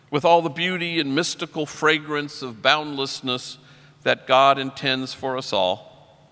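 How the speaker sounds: normal talking voice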